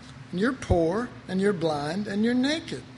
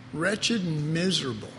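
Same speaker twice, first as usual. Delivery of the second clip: ordinary talk, nothing marked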